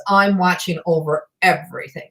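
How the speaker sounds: ordinary delivery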